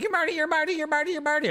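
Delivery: high, excited squealing fan; starstruck fan. Pretend. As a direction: gush like a fan spotting a celeb. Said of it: "you're like".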